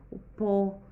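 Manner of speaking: put-on teacher stressing pó for kids; stressed syllable shown. Shown as a demonstration. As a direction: punch the pó of cipó. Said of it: "que é a sílaba mais forte".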